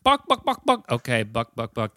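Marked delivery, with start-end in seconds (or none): 0.0-0.9 high, quick chicken clucks; high-pitched bock-bock-bock; chicken clucking. Retold or imitated. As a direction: imitate a clucking hen. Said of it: "and the chicken went".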